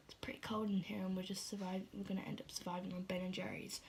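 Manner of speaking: hushed whispered complaint. Retold or imitated. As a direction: whisper it, hiding in the garage. Girls whispering in garage.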